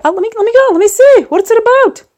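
hammed-up eager squeal; mock excitement. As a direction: squeak it out, thrilled. High squeaky eager imitation.

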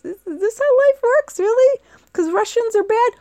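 high, loud bewildered question; incredulous child. Retold or imitated. Her younger self questioning the booing crowd, incredulous. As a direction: ask in wide-eyed disbelief.

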